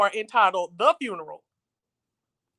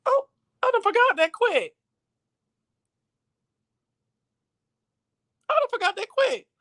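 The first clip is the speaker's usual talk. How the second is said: high mock surprise; 11 semitones higher than usual. Real, not acted. Self-mocking high exclaim.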